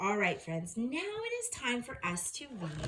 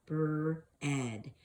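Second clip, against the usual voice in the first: slow, lower, drawn out; slow modelled word; 5 semitones lower than usual. Shown as a demonstration. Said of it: "the first one we've seen several times".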